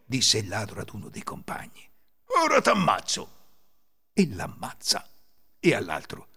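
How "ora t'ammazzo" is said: loud, high angry threat; threatening. A narrator's reading. Thief turning on his companion, angry threat. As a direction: threaten with rising temper.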